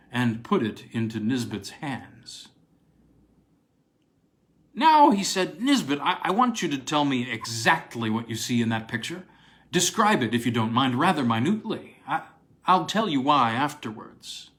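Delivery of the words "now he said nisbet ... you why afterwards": eager don Williams. Acted. Williams presses Nisbet to describe the picture, eager and keyed up. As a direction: urge him on, barely containing excitement. Williams urgently questions his colleague.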